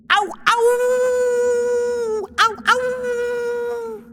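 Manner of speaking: very high howling wolf; howl of pain. Acted. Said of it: "hurla de douleur". (hurlement de douleur)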